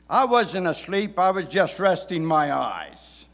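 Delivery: loud, high indignant denial; defensive dad. Retold or imitated. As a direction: insist like a dad caught napping. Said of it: "words that many dads have said".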